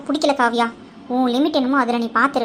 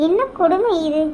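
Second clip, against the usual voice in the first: exasperated groan; 5 semitones higher than usual. Acted. Exasperated about her sister.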